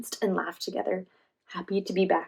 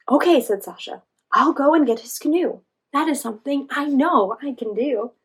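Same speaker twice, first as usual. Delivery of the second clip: higher, louder determined voice; eager, louder and higher; Sasha's voice; 5 semitones higher, 7 dB louder than usual. A narrator's reading. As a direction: brave squirrel stepping up. Sasha volunteers in story.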